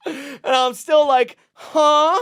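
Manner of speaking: high, loud, slow laughing confusion; can't stop laughing while telling it; laughing through it. Real, not acted. Retelling his confusion while laughing.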